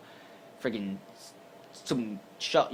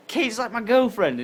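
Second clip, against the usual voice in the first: higher, slower, louder insistence; pitched-up incredulous explanation; exasperated; 11 semitones higher, 6 dB louder, slower than usual. Retold or imitated. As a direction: spell it out to the cop. Retold answer to officer.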